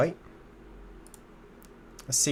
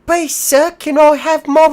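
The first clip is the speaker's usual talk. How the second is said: high, loud pleading urchin voice; pleading beggar; 11 dB louder than usual. Pretend. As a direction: beg for scraps like a Dickensian orphan. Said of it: "a, a street beggar".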